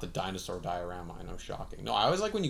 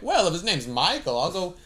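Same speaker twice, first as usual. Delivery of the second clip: high, loud mock-naive voice; mock reassured; 7 semitones higher, 4 dB louder than usual. Naive listener hears the killer's name, mock reassured. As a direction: play someone cheerfully won over.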